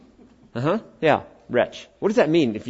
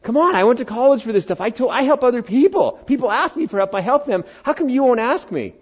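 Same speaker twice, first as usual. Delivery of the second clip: high, fast complaining plea; mock-wounded protest; mock hurt whining; 10 semitones higher than usual. Real, not acted. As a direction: whine that nobody asks you.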